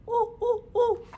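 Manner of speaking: very high, loud monkey calls; monkey hoots. A narrator's reading. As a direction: hoot like a cheeky monkey. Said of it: "the monkeys only shook both their hands at him and said".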